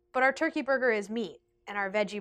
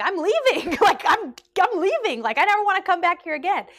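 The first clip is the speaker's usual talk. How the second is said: higher and louder, imitating an upset teen yelling she's leaving; 8 semitones higher, 7 dB louder than usual. Teen customer telling the manager she is leaving, imitated upset yell. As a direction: play the hurt customer storming out, loud and fast.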